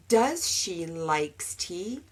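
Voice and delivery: slow mock error; learner's mistake. Shown as a demonstration. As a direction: say it slowly, as the wrong version.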